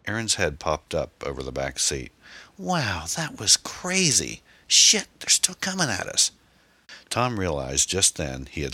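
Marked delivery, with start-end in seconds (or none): 2.6-6.3 high, drawn-out amazement turning to loud alarm; excited alarm. A narrator's reading. Passenger after the explosion, excited then alarmed. Passenger reacts to blast, pursuers coming.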